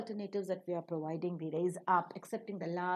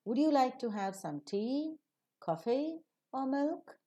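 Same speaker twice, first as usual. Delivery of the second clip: TEA and COFFEE rising, MILK falling at the end; rising tone on alternatives; 5 semitones higher than usual. Shown as a demonstration. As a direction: lift the pitch on tea and coffee, drop on milk. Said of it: "they receive the rising tone".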